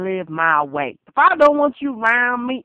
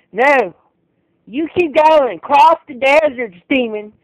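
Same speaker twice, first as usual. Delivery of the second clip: higher, louder commands; shouting; 4 semitones higher, 6 dB louder than usual. Real, not acted. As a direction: drive them off loudly. Shouting at imagined demons.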